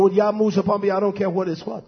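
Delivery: normal talking voice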